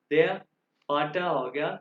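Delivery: ordinary delivery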